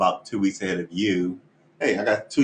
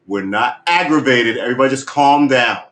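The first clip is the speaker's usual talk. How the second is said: loud, higher insistence that all is calm; mock calm; 4 semitones higher, 8 dB louder than usual. Telling the band and viewers to calm down, ironically loud. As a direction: shout that everyone's calm, ironically. Ironic denial of being upset.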